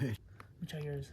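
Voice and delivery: soft slow whisper. Pretend. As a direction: whisper it sneakily. Whispering during pretend meal.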